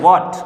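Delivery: "what" said as "wot", no h sound; silent h. Shown as a demonstration. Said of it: "जैसे इसको मैं पढ़ता हूँ".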